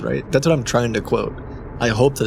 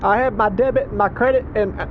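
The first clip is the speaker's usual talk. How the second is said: higher, hurried caller voice; flustered caller voice; 8 semitones higher than usual. Pretend. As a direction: play a frazzled driver on the phone.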